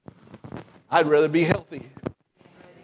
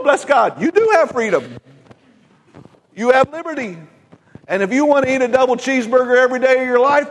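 higher, punchy pulpit delivery; preaching at full force; 6 semitones higher than usual. Acted. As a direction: lift the crowd with each line.

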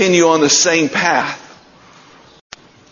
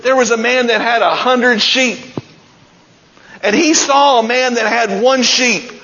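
high, ringing preacher's build as the story climbs; preaching at full force; 6 semitones higher than usual. Acted. Preacher to congregation, telling of Nathan confronting David, ringing and high. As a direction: preach it, pitch rising with the story.

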